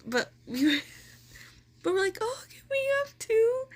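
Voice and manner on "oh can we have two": timid high ask. Retold or imitated. Asking the girl for albums.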